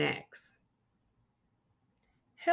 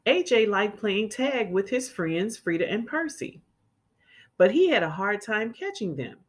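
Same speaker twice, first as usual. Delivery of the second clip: clear, lively storybook reading; children's story read-aloud. A narrator's reading. Teacher reading a picture book to children, lively. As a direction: read it like storytime for little kids.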